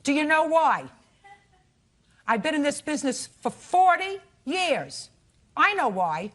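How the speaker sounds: loud, high scolding; stern scolding. Real, not acted. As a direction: judge laying down the law. Judge scolding the plaintiff.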